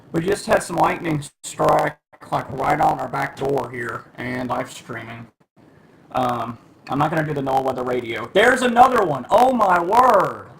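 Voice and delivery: startled by lightning, calling out to viewers; alarmed excitement. Real, not acted. Lightning strikes right by door.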